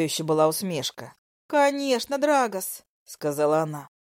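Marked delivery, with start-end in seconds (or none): 1.5-2.8 high, smug female voice; smug mistress. Acted. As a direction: gloat like a jealous lover.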